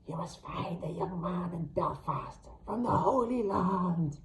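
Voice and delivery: slow unvoiced ghostly whisper; deathly hag whisper. A narrator's reading. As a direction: instruct like a voice from the grave. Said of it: "her deathly voice instructed".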